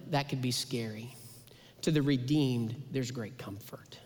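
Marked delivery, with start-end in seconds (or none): 2.9-4.0 soft, near-whispered comfort; almost whispered reassurance; hushed. Real, not acted. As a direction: drop to a breathy hush. Hushed contrast line in sermon.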